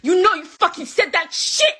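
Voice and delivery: climbing into a furious yell; furious accusation. Real, not acted. Angry accusation building.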